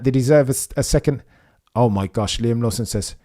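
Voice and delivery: ordinary talk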